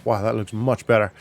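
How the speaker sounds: ordinary delivery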